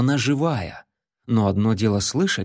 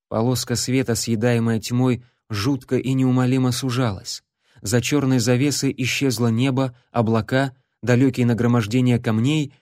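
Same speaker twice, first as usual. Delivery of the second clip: ordinary delivery, nothing marked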